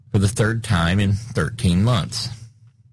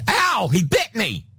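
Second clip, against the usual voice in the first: high, slow yelp of pain; alligator crying out; 12 semitones higher than usual. Pretend. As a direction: cry out high like a bitten gator. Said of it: "And suddenly the alligator".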